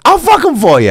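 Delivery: hammed-up retold offer; insistent woman, imitated. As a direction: push it out high and loud as her quote. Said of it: "And she was like".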